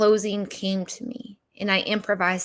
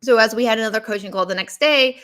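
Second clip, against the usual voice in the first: ordinary talk, nothing marked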